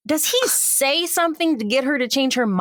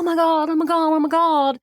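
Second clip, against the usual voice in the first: higher, gushing mock excitement; starstruck gushing; 5 semitones higher than usual. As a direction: play her excited gush, voice lifted. Retelling her starstruck reaction.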